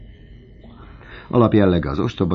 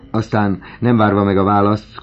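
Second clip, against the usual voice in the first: usual speaking voice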